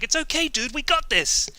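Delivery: high mock-cheerful teammate reassuring you; cheery teammate. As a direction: sell false reassurance, high and casual. Teammate voiced in a story.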